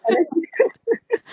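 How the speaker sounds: slow words dissolving into a high breathless laugh. Real, not acted. Recalling the client starting to cry, breaking into laughter.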